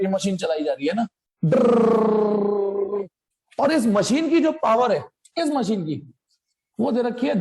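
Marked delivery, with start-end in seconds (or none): 1.4-3.1 long rolled "drrr" on one steady note; imitated drill noise. Teacher acting out drilling the aluminium block, imitated drill noise. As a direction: buzz like a drill boring in.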